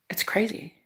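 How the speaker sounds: тихий шёпот; whispered aside. Real, not acted. Aside comment to viewers.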